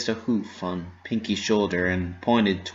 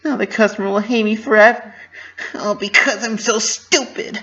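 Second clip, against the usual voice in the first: high, loud, rushed and half-crying; tearful self-blame; 13 semitones higher, 6 dB louder than usual. Acted. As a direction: blurt it out near tears, blaming herself. Pinky despairs after the mistake.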